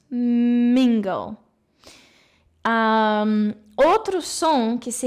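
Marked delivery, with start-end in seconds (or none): 0.1-1.4 Mingle said with a long, pressed M; lips together on M. Shown as a demonstration. Teacher showing the kissing lip shape of M, slow and pressed. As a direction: hold the lips closed on the M of Mingle. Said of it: "Colocar os lábios juntos".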